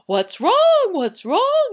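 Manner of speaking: very high, loud, frantic question; alarmed townsfolk. Acted. Whole town coming into the house, alarmed. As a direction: shout it in mock panic.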